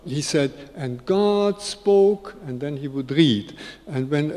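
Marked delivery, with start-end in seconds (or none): 0.8-2.3 slow, raised reading of holy words; solemn scripture reading. Acted. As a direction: intone it slowly, higher than usual. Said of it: "in the habit of reading the s-, scriptures".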